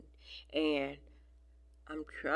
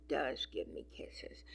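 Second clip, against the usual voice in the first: whisper; 3 semitones lower, 7 dB quieter than usual. Real, not acted. Cooing over kittens' kisses.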